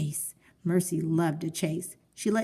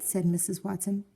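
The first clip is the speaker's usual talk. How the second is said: ordinary delivery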